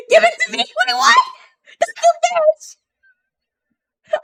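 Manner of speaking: high, loud, laughing shout; excited shriek. Real, not acted. Streamer shrieking at the chatbot's reply, laughing and excited. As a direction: squeal it out, half laughing.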